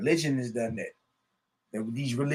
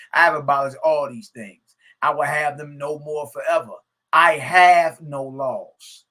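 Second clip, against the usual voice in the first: proclaiming scripture; 7 dB louder than usual. Acted. Preacher reading the creator's words to his audience, proclaiming. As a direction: read it as the creator's decree.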